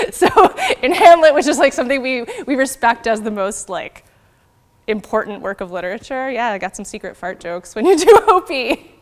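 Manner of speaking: laughing. Real, not acted. Giggling over the fart joke.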